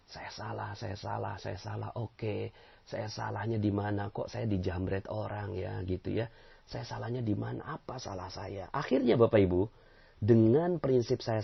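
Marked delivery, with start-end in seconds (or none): 0.1-8.7 softer, murmured to oneself; humble inward confession. Shown as a demonstration. Preacher models reflecting after a snatch theft, murmured. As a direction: reflect quietly after being robbed. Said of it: "Dalam diri kita".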